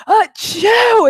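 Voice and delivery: a huge, high, exaggerated sneeze; mock sneeze shouted for the whole store; loud fake sneeze. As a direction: blast out a sneeze as loud as you can. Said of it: "this fool goes".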